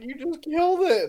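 put-on high, wailing girl voice; hammed-up upset passenger; mock crying girl. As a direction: whine it like a teary girl. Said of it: "crying because she's like".

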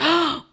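high acted gasp; sharp intake of mock panic; panicked gasp. Server panicking over the pork, panicked gasp. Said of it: "I'm literally panicking".

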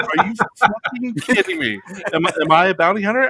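mock incredulous. Mocking the guard's question.

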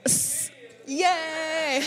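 giggling high then a soft yay; high giggle. Real, not acted. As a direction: giggle and cheer softly. Laughing at applause.

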